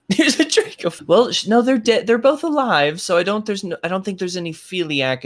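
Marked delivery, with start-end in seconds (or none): none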